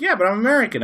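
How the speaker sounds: hammed-up entitled tourist; smug American. Tourist excusing himself to supervisor, hammed-up smugness. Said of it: "I'm like".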